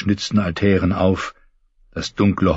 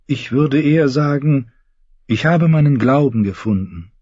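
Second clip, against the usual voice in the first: put-on higher, devout young knight; Lancel; 8 semitones higher than usual. Lancel confessing his new faith to James, earnest. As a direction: speak like a pious, fervent cousin.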